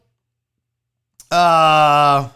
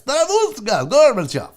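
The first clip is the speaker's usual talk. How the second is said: put-on Russian accent; 4 semitones higher, 3 dB louder than usual. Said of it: "Terrible Russian name".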